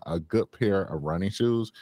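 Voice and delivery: ordinary talk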